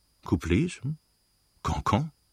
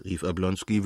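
ordinary delivery, nothing marked

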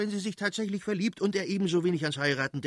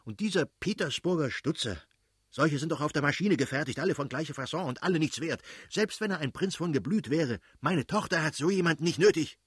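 high-pitched, scornful tirade; acted irate father's rant; ranting angrily; 6 semitones higher than usual. A narrator's reading. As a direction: rail on in exasperated indignation. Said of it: "brauste der Fürst plötzlich auf und schrie".

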